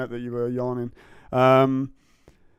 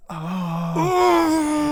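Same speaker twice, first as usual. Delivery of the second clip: mock whimper; 14 semitones higher, 4 dB louder than usual.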